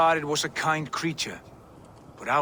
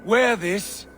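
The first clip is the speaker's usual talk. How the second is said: higher and louder, coaxing across the distance; raised persuasive voice; 6 semitones higher, 3 dB louder than usual. Acted. The visitor urges the wolf to wear the collar, raised coaxing voice. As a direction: call up to him, selling the offer.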